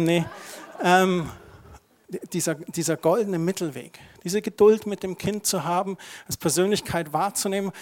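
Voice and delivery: ordinary delivery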